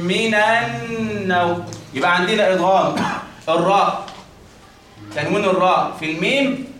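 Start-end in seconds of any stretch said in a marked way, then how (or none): 0.0-1.7 من النوم slow, نون held two beats; ghunna. Shown as a demonstration. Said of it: "فتقول إيه؟". (غنة بمقدار حركتين)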